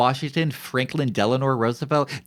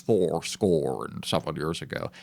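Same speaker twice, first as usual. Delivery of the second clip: low, softer pop-culture Lincoln voice; deep-voiced Lincoln; 5 semitones lower, 5 dB quieter than usual. Streamer mimicking the popular image of Lincoln, deep-voiced. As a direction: lower the voice into a stock Lincoln. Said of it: "he's very deep-voiced".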